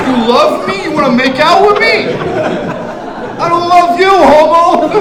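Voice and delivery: hammed-up outraged shout; mock shouting. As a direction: yell it at the fan. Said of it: "he's like".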